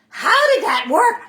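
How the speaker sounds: loud, high, drawn-out astonishment; mock amazement. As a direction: exclaim as if at a magic trick. Mock amazement at peeled garlic.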